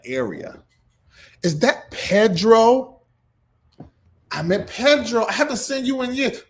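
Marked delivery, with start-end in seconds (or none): 1.4-6.4 high, loud, delighted calling out to friends; excited greeting. Real, not acted. Vlogger spotting Pedro in the live comments, excited high greeting.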